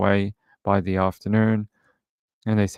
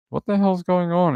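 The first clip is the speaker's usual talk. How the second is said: higher-pitched retold disbelief; mock bewilderment; 9 semitones higher than usual. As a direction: act out being baffled by a commotion. Retold reaction to the yelling.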